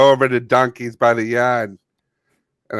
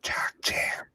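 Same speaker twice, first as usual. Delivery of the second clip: whisper; 13 dB quieter than usual. Real, not acted. Aside quoted.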